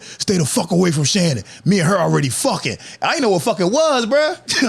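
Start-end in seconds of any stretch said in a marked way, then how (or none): none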